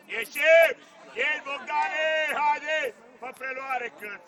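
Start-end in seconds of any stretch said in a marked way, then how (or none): 0.1-2.9 loud, sharp call to push up; full-voiced coach's yell; touchline shout. Real, not acted. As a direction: bark the command across the field. Coach calling out to the team.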